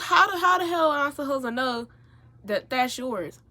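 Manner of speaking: loud, high-pitched protest; exasperated. Retold or imitated. Protesting the sock accusation, exasperated. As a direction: burst out in indignant protest. Retells indignant reply about socks.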